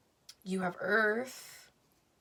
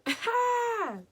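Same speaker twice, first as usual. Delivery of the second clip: a sudden high whoop of a laugh; loud burst of laughter; 6 dB louder than usual. Real, not acted. Laughing out loud after the interruption. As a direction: crack up loudly at the interruption.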